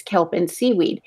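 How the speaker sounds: usual speaking voice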